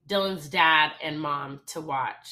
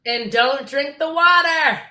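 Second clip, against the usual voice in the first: higher, louder, slow tongue-in-cheek caution; mock warning; 9 semitones higher, 9 dB louder, slower than usual. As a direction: ham up the fussy advice.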